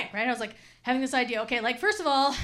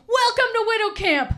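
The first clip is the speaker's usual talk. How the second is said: sign read cheerfully; 8 semitones higher, 5 dB louder than usual. Retold or imitated. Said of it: "and it would say".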